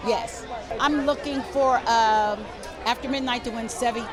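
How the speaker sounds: ordinary delivery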